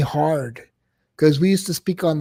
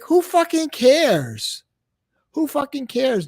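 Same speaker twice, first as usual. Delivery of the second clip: much higher, slow, exasperated; exasperated disbelief; 9 semitones higher, slower than usual. Real, not acted. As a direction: drag it out in disgust. Venting at dismissive host.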